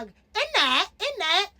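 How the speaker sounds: high mocking British "in that" with a bouncing rise; mock British accent. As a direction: parody the British "in that". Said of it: "like the British accent".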